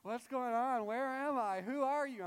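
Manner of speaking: put-on high, loud panicked wail of a woken child; hammed-up distraught little girl; distraught daughter. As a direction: imitate a disoriented child crying out. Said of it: "My daughter is distraught".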